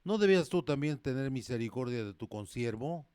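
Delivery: loud, forceful pulpit reading; full-force sermon voice; fiery preaching. Acted. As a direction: preach it out to the whole church.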